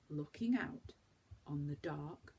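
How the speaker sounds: plain reading voice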